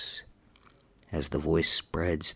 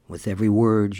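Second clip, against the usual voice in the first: ordinary delivery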